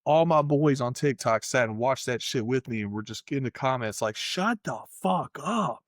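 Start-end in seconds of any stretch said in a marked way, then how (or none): 4.1-5.8 slow, drawn-out, higher dismissive shut-up; fed-up mock yell. TikTok chat telling the caller off, slow mocking dismissal. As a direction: groan it like the whole chat has had enough.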